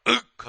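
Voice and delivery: mock roar. Bear roars at the sparrow.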